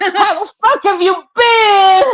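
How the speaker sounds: high, loud delighted shout; excited greeting. Retold or imitated. The parole officer greets his old customer, excited shout. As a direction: blurt it out overjoyed to see an old friend.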